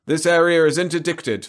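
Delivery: put-on loud, high interruption in broad South Propendium accent. A narrator's reading. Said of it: "now with a broad South Propendium accent".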